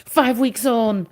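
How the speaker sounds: ordinary talk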